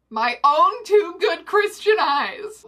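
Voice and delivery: loud, high, drawn-out disbelief; mock outrage. Reader scandalised by the chapter, mock-outraged exclamation. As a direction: exclaim it slowly, hammed-up scandal.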